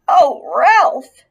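high, loud wail of despair; anguished cry. A narrator's reading. Mother crying out as Ralph leaves, loud. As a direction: cry his name out in anguish.

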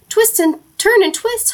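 high, urgent goose honk. A narrator's reading. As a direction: honk out the orders high and excited. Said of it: "honked the goose".

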